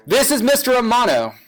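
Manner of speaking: loud, projected test line into the mic; loud teacher voice. Shown as a demonstration. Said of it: "talking really loud in your mic".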